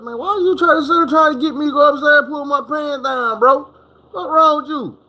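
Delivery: high, incredulous pushback; answering the barber's demand in high disbelief; incredulous retort. Retold or imitated.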